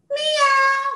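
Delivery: very high little meow; cat meow. Shown as a demonstration. Showing Janie what the cat says, high meow. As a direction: mew like a tiny cat. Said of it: "The cat says".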